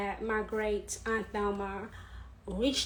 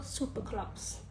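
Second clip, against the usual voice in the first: hushed; 7 dB quieter than usual. Real, not acted. Fond memory of great aunt.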